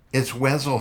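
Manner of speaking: high pained gasp; squeezed out in pain. Acted. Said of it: "he gasped".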